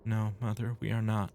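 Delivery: submissive daughter. Acted. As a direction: answer your mother obediently. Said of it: "The daughter is submissive as always".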